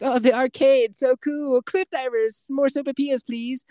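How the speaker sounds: put-on high, thrilled kid voice; gushing kid. Cartman racing through the restaurant, high and thrilled. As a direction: rattle off the treats in a squealing kid voice. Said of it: "He's like".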